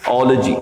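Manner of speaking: ओलॉजी as "ol-jee"; correct ending. Shown as a demonstration. Teacher answers his own drill question. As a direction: clip ओलॉजी to two beats. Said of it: "क्या कहलाते हैं ये?".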